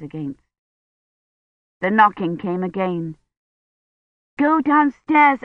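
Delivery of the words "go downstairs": higher, slow call through a door. A narrator's reading. Kivrin calls to the knocking child, high and insistent. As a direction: call it out slowly, pitched up.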